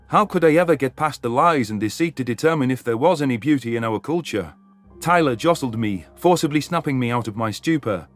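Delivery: usual speaking voice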